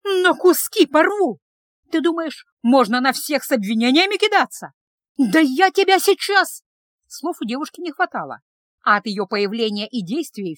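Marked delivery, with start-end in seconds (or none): 0.0-6.6 high, loud, slowed raging threats; frenzied fury. Acted. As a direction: spit each threat out in a rage. Girl attacks man in fury.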